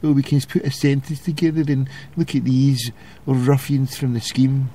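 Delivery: a hint of a snooty liberal, lightly voiced; mock posh elite. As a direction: look down your nose at the ruffians. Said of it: "thinking because".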